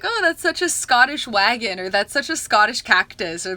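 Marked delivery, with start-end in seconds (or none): 0.0-1.8 higher, louder put-on Scottish accent; mock Scottish accent. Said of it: "but in an accent, we were like". 1.9-3.5 louder, lightly put-on Scottish accent; mock Scottish accent. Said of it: "but in an accent, we were like".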